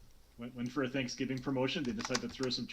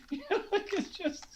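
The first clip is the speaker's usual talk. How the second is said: laughing. Real, not acted. Laughing at own joke.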